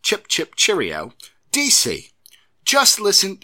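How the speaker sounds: hammed-up English accent warm-up. Host using phrases to get into character, hammed-up English accent. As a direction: lift it high into a stagey English accent. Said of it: "a phrase people use to get in".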